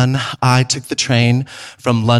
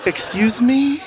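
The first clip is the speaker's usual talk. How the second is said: high, startled and laughing; shocked "excuse me"; 9 semitones higher, slower than usual. Retold or imitated. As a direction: react in mock disbelief. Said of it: "I was like".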